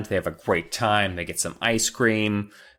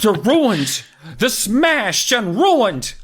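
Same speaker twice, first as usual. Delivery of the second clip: loud, high, drawn-out mock anguish; hammed-up cry of devastation; wailing dad; 13 semitones higher, 7 dB louder, much slower than usual. Dad over smashed tomatoes, overblown mock wail.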